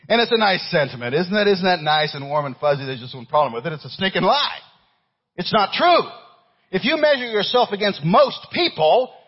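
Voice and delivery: loud, high-pitched pulpit rebuttal; full-force preaching. Acted. Preacher refuting a song's sentiment, full-force preaching. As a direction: knock the idea down forcefully.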